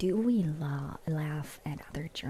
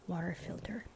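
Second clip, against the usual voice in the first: ordinary talk, nothing marked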